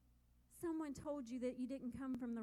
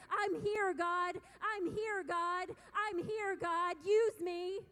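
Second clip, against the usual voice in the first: intense; 3 semitones higher, 7 dB louder, slower than usual. Acted. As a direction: call out full force to God. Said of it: "if I sound intense tonight".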